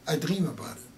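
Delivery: ordinary talk, nothing marked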